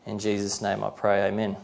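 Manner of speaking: hushed end of a prayer. Real, not acted. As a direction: close a prayer quietly and reverently. Closes an opening prayer.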